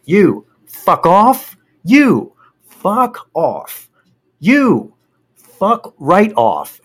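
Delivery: high, slow, punched-out orders; acted-out exasperated send-off; retold angry dismissal. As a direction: dismiss them one at a time, drawing out each word. Pointing, dismissing each one in turn.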